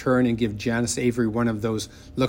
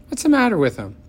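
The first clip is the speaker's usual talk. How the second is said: put-on much higher, louder little girl voice; suspicious child; 11 semitones higher, 6 dB louder, faster than usual. A narrator's reading. Little sister doubting the paper dolls, high suspicious. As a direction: doubt the gift.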